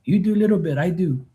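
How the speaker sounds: high-pitched, eager, mock-accented worker voice; imitating the worker. Worker insisting to dad he'll do the work, in a high, eager put-on voice. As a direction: pitch it up like the polite helper insisting. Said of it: "He told".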